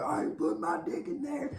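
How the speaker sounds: very high put-on character voice; high-pitched mock outburst; mock protest. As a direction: squeal it like a cartoon man refusing. Acts out the man's refusal.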